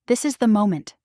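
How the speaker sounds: ordinary delivery, nothing marked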